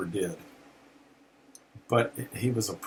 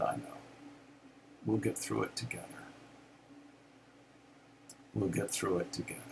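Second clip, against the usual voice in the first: tender comfort; 11 dB quieter, faster than usual. Retold or imitated. Wife Kathy comforting grieving husband, soft and tender. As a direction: gently reassure him. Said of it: "she held me and cried with me".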